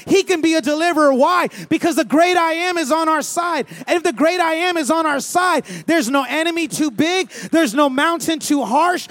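loud, high, driving sermon voice; full-force preaching. Real, not acted. Pastor rousing the congregation about God, loud full-force preaching. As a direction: preach it hard and loud to the room.

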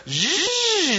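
high imitated zip line whizz; zip line buzz. Describing the zip line ride to the church, high buzzing imitation. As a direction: make the whirring zip line sound. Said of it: "the zip line kinda goes".

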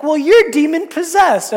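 loud, high-pitched mock accusation; retold upset outburst. Upset religious rulers hit back at Jesus, loud mock outrage. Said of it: "they're, like, so upset".

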